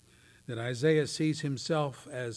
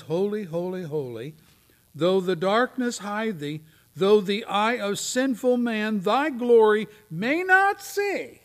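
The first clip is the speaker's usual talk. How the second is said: rising, louder preaching of the hymn, slowed; fervent hymn recital; 7 semitones higher, 9 dB louder than usual. Acted. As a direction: proclaim it with mounting fervor. Preacher quotes hymn with fervor.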